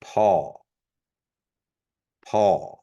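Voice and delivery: paw with a plain puffed p; Kiowa P. Shown as a demonstration. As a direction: say paw with a breathy p. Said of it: "a P sound for paw".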